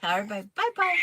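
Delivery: coaxing through a laugh; giggling bye-bye prompt. Real, not acted. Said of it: "Can you hear how hoarse she is".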